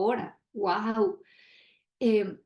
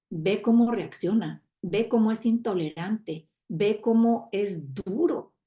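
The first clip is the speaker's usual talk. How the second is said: ordinary talk, nothing marked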